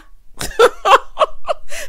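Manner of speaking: high, loud burst of laughter; loud cackle. Real, not acted. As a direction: cackle loudly at the absurdity. Laughs at the man's exit.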